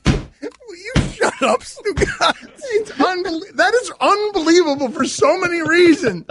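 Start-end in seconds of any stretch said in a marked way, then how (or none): 0.1-4.9 exasperated. Real, not acted. Telling cohost to stop interrupting.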